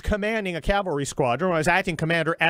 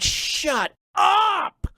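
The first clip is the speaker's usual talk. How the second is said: hammed-up fake crying shout, much higher; mock wail; 12 semitones higher than usual. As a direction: wail like a blubbering baby in mockery. Mocking sobbing congressman.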